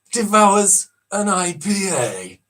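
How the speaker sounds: slow, hushed wildlife narration; gentle awed nature presenter; nature documentary. Acted. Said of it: "One of the most famous guys ever".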